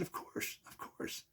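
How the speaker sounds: whispered reassurance; soft unvoiced of course. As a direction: whisper it back gently. Answering her softly.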